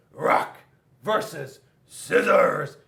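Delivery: shouted, drawn-out challenge; loud battle cry. Acted. Rock declares the fight on Scissors, loud battle cry. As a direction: bellow the matchup like a ring announcer.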